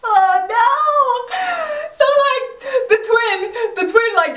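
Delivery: laughing helplessly while telling it; real laughing cry. Real, not acted. Recapping the twin reveal, laughing high and squealing.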